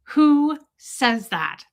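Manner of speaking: slow incredulous scoff; drawn-out disbelief; self-mocking cringe. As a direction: scoff at yourself. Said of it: "I was like".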